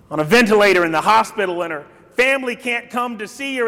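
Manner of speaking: ordinary talk, nothing marked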